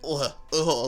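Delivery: low mock groans of disgust. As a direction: groan like it's too hot and tight. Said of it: "I was like".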